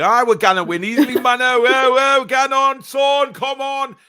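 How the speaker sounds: high, loud matey shouting; excited cheering. Retold or imitated. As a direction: cheer them on like a fan. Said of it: "Like me and Danielle".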